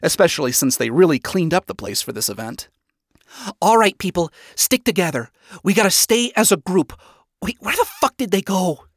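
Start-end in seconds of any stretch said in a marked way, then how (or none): 3.6-8.9 higher-pitched, commanding group leader; bossy instructions turning to bewilderment; Vaggie. A narrator's reading. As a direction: order them to stick together, then exasperated. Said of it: "Vaggie commanded the hotel members".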